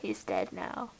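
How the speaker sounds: deadpan whisper. Acted. Mock mournful aside.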